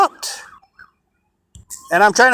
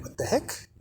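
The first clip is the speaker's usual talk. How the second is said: whispered aside; 3 semitones higher, 13 dB quieter than usual. Retold or imitated. Own reaction quoted.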